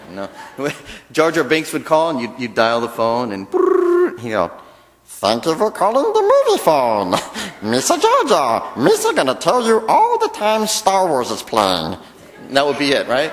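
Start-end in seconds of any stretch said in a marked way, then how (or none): 3.5-4.2 phone ring. Pretend. Phone ringing imitated. 5.0-12.0 high, louder goofy alien voice; Jar Jar Binks. Pretend. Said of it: "get Jar Jar Binks to do it".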